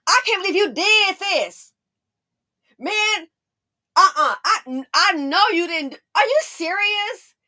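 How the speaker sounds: high, loud fake outrage; mock argument. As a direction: pretend to pick a fight for fun. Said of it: "I would say something like".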